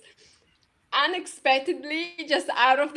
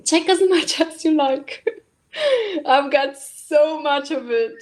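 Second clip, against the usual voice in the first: louder, laughing through the words; laughing offer; 6 dB louder than usual. Retold or imitated. The universe offering everything, laughing. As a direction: offer it generously, breaking into a laugh.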